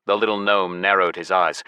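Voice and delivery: plain reading voice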